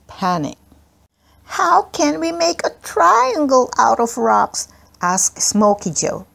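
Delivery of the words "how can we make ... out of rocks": put-on high, worried child's voice; a little boy fretting aloud; small boy voice. A narrator's reading. Smokey Joe asks, worried.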